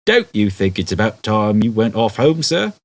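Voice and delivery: put-on patient constable steering a drunk home; policeman voice. A narrator's reading. Policeman telling a drunk Ford to go home, polite. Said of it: "The policeman would usually say something like".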